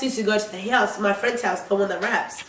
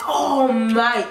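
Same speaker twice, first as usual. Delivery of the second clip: ordinary delivery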